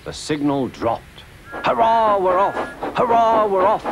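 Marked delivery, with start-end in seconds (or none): 1.5-3.8 high, jubilant hurrah; puffed cheer. A narrator's reading. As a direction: cheer as you pull away. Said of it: "puffed Thomas".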